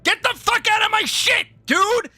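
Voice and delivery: high-pitched shouting rant; angry tirade. Real, not acted. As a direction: yell at the fake fans to clear off. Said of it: "I'm still mad".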